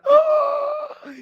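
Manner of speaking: put-on sudden high childish shriek; grito de hijo. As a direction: wail of a child losing his phone. Hijo grita al quitarle el celular. (grito agudo de niño berrinchudo)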